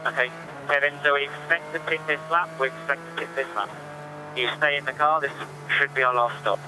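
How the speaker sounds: engineer over team radio; crackly radio instructions to driver; terse pit radio call. Real, not acted. Team radio to driver Kevin Estre.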